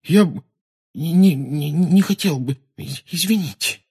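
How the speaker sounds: put-on high, stammering embarrassed boy; squeezed-out apology. Dean apologising after his blunder, flustered stammer. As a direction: stammer and force the words out, high. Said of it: "выдавил он из себя и умолк".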